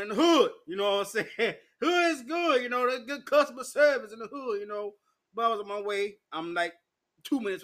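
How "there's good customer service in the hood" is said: usual speaking voice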